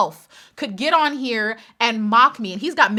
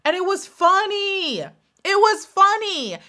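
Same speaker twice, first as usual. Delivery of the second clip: much higher, drawn out It was funny; high, slow amused insistence; 8 semitones higher, slower than usual. Real, not acted. To viewers about the Farms joke, high slow amused. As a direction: savor how funny it was.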